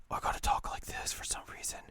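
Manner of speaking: mock breathy whisper; low and whispery. Co-host mimicking a mumbly voice actor, whispery. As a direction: whisper it, exaggerating a hushed game hero. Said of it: "he gets so low and whispery".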